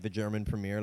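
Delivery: normal talking voice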